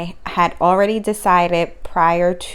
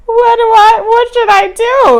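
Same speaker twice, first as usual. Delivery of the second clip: high, loud desperate cry; frantic question mid-labor; panicked plea; 11 dB louder than usual. Retold or imitated. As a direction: beg for help, voice pitched way up.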